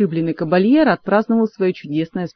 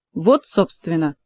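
usual speaking voice

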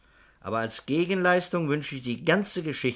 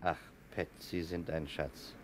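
warm thanks; 7 semitones lower, 8 dB quieter than usual. Acted. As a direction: thank her gently. Grateful thanks to Pat.

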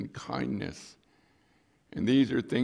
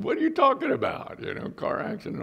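high, louder mock disbelief; imitated confused son; 4 dB louder than usual. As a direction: play the baffled son, pitched up. Imitates son's confused reply.

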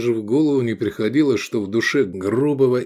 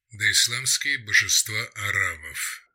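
ordinary delivery, nothing marked

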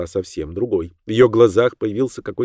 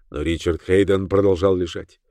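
ordinary delivery

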